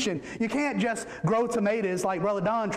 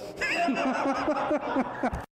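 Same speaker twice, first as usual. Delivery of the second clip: high voice breaking into laughter; laughing; 4 semitones higher than usual. Real, not acted. Preacher cracking up at his own genie joke, laughing. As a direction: laugh through the words after the punchline.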